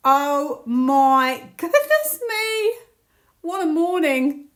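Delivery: loud, high, drawn-out exclamation; real amazement. Real, not acted. Tarot reader astonished by the morning's reading, thrilled.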